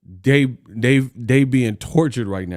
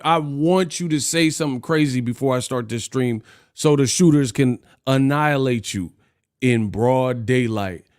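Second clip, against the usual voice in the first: usual speaking voice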